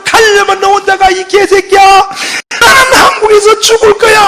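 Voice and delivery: refusing to go to America, he yells and curses through tears; Syngman Rhee crying and cursing. Retold or imitated. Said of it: "막 울면서 욕을 파가지고".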